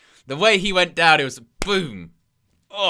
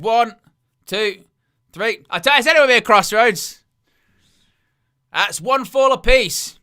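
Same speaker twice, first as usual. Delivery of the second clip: loud, high pinfall count; counting the three, fired up; excited count; 7 semitones higher, 7 dB louder than usual. Real, not acted.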